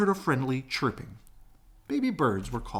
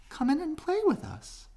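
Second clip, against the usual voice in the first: very high, cheerful invitation; young swans inviting. Acted. Young swans inviting the duckling, very high and cheerful. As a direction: invite him like bright little birds. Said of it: "they asked".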